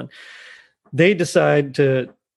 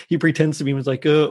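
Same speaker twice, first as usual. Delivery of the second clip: ordinary delivery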